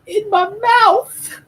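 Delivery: goofy build to a high, loud, drawn-out finish; dumbed-down voice. Retold or imitated. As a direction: play it brain-addled, stretching the last words. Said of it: "It makes her dumb".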